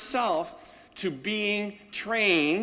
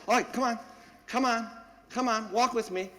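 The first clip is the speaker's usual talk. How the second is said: coaxing the puppy; 5 semitones higher than usual. Retold or imitated.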